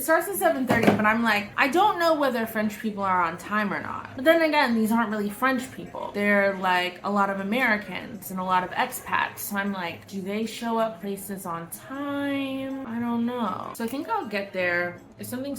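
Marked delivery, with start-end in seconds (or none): none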